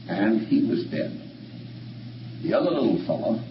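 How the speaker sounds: ordinary talk, nothing marked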